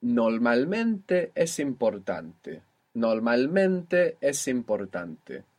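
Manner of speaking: Normalmente es importante said slowly and evenly; slow clear sentence. Shown as a demonstration. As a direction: read the Spanish sentence slowly for a learner. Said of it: "what would it mean if I said to you".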